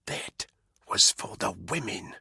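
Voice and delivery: whispered. A narrator's reading. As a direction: breathe it quietly over the body. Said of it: "Caitlin whispered".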